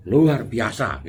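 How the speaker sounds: belts out luar biasa loudly and proudly; loud boast. Retold or imitated. A friend answering how are you, loud and proud. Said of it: "gitu ya ngomongnya".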